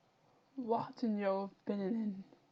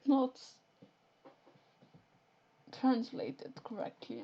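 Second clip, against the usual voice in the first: normal talking voice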